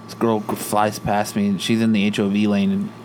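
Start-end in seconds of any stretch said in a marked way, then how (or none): none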